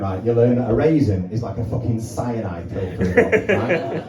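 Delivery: usual speaking voice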